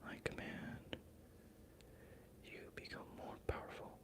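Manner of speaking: very soft, hushed command; whispered incantation. Real, not acted. Streamer speaking empowerment to the viewers, hushed incantation. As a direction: breathe it out like a quiet spell.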